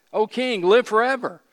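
high, loud acted reply; calling back. Daniel answering the king from the den, calling out. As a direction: call up out of a pit.

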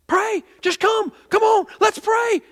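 high, loud pleading calls; urgent altar call. Retold or imitated. Pastor stopping his sermon to call church to pray, urgent. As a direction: call them forward in short, urgent bursts.

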